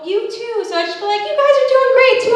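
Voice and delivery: high, fast squeaky you-too; reflexive reply. Retold or imitated. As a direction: blurt it back on reflex.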